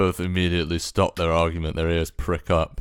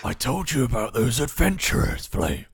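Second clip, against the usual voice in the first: second wolf voice; 4 semitones higher than usual. Acted.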